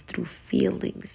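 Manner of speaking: normal talking voice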